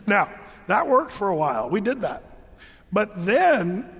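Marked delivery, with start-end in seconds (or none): none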